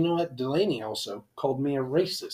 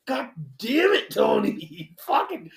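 exasperated mock outburst; 10 semitones higher, 4 dB louder than usual. Driver blaming his friend for the ticket, exasperated retold outburst. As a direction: wail the blame at your friend.

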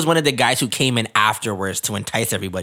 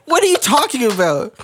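incredulous; 10 semitones higher than usual. Retold or imitated. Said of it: "I'm like".

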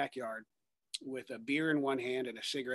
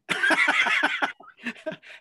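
high laugh; 8 dB louder than usual. Retold or imitated. Laughing at his own life.

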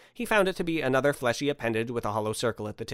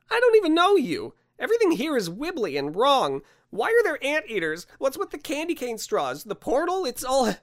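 high-pitched indignant rant; exasperated Andrew; 14 semitones higher, 5 dB louder than usual. Acted. Man rants at Santa about this strange place, exasperated. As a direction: pile up bewildered complaints in a high voice.

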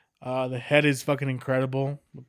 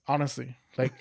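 ordinary delivery

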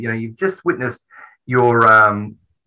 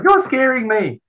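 put-on scared little boy. Little boy under plastic bag.

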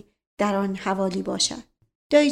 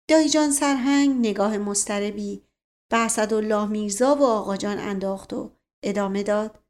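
plain reading voice

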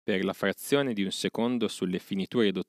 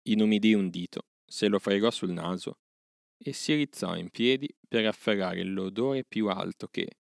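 ordinary delivery

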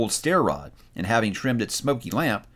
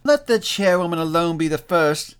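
high, raised-voice charwoman; crying out; 7 semitones higher, 4 dB louder than usual. A narrator's reading. Charwoman claims first place among the visitors, crying out. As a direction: call it out high and forceful. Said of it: "cried she who had entered first".

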